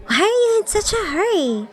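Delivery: high-pitched, indignant question; high, complaining demand; miffed. A narrator's reading. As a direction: voice a put-out friend way up high. Scowling friend confronts her after chase.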